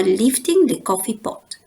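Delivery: ordinary delivery, nothing marked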